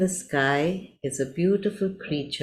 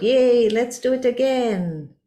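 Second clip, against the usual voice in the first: high, louder cheer; friends shouting; 8 semitones higher, 4 dB louder than usual. A narrator's reading. Caterpillar friends cheering after popping their skins, high excited shout. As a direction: cheer it out like happy little caterpillars. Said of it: "your friends shout".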